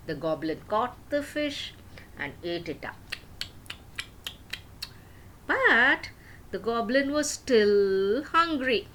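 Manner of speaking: usual speaking voice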